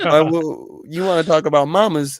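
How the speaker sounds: hammed-up street challenge; loud challenge. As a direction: square up over mama, loud. Acted loud challenge over mamas.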